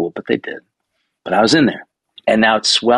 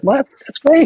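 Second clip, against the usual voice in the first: a much higher, louder burst of pleased surprise; mock delight; 5 dB louder than usual. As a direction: yelp it out like great news just landed. Hearing about Canon Legends.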